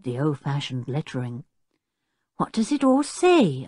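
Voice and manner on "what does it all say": great interest. A narrator's reading. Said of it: "with great interest".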